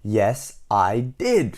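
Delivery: usual speaking voice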